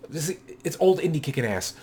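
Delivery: usual speaking voice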